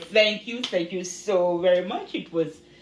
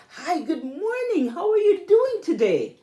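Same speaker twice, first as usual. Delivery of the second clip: high, loud cheery hello to a passerby; bright greeting; 10 semitones higher, 7 dB louder than usual. Acted. Greeting a neighbour on the street, high and cheery. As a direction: sing out a good morning to a stranger.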